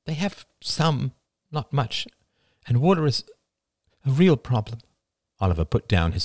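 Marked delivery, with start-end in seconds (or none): 0.0-4.8 higher, anxious mother's voice; mother fretting over family; worried mother. A narrator's reading. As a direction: plead gently as the worried mother.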